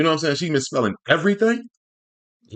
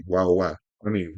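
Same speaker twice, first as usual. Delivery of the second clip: normal talking voice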